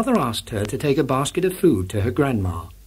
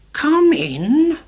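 put-on high, quavery old granny voice; grandma voice; 14 semitones higher, 5 dB louder than usual. A narrator's reading. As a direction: call out like a frail old granny. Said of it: "called Grandma".

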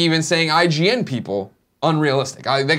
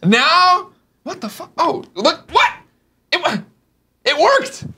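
high squealing disbelief; excited; 6 semitones higher, 4 dB louder, much slower than usual. Real, not acted. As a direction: shriek with giddy surprise, laughing. Phone buzzes, host shrieks excitedly.

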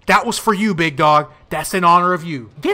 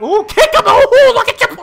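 excited squeal; 12 semitones higher, 5 dB louder than usual. Real, not acted. Excited at his own move.

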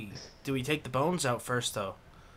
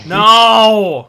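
loud shout; 8 semitones higher, 17 dB louder than usual. Real, not acted. Reacting to gross game moment.

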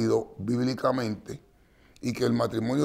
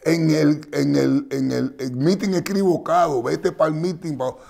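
mock retort; 4 semitones higher, 5 dB louder, slower than usual. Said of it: "Le dijo".

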